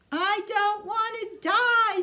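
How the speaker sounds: high, loud, drawn-out scream. A narrator's reading. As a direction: wail it while throwing himself down. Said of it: "screamed Wilbur".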